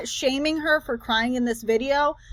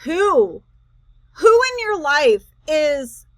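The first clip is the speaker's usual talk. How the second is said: incredulous; 2 semitones higher, 4 dB louder, slower than usual. Real, not acted. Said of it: "this is the crash out where I'm just like".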